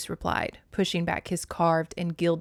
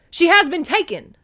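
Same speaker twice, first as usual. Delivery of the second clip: loud, high-pitched outcry; insistent; 11 semitones higher, 11 dB louder than usual. A narrator's reading. Mother insists her daughter was abducted, urgent. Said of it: "Demeter insisted".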